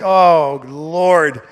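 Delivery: loud despairing groan. Retold or imitated.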